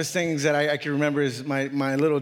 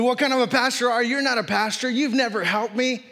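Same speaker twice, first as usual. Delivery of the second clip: raised-voice imitation of an angry man, higher pitch; retold yelling; 8 semitones higher than usual. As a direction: fire off the accusations in a high, heated voice. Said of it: "and so he started yelling".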